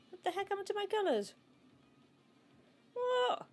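high-pitched, incredulous squeal; baffled outcry. Real, not acted. Baffled by colours changing in game.

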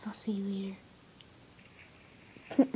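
whispered softly; whispering. Real, not acted. Unvoiced whisper.

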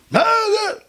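very high held yelp; outraged neighbour. Retold or imitated. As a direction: shoot the question up into a squeal. Neighbour reacts to being sent away. (צווחה גבוהה של שכן)

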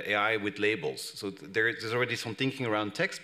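ordinary delivery

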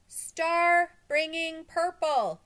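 slow, loud, high holler; shouting. A narrator's reading. Crowd shouts up to the roof, slow high shouting. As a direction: stretch each word to carry up high. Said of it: "they shouted again".

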